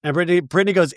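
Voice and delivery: ordinary talk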